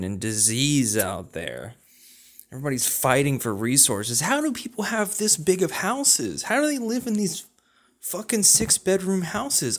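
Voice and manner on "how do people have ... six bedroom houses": incredulous whine. Acted. Incredulous rant about big houses.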